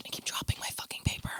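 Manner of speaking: fast voiceless whisper aside. Real, not acted. Grumbling at dropped paper, whispering. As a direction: mutter it off-mic. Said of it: "aside muttered".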